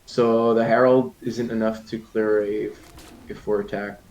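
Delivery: lively play-by-play casting; esports caster. Acted. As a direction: call the game like a caster. Caster calling the match.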